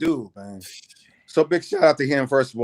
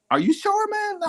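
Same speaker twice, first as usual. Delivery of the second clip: much higher, hesitant query; imitated doubtful question; 3 dB quieter than usual. Teller doubting Bobby's trip downtown, high-pitched and doubtful. Acting out reluctance to leave work.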